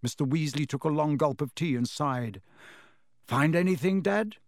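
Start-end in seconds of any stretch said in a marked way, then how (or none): 3.3-4.4 bright, rising eager ask; eager question. A narrator's reading. Fred asks his dad eagerly about the raids. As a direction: ask like a keen son hoping for news. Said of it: "said Fred eagerly".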